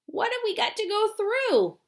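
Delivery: high, alarmed question shout. Acted. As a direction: call out in worried surprise. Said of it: "shouted Grandpa Joe".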